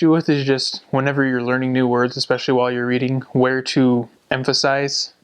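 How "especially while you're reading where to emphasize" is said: normal talking voice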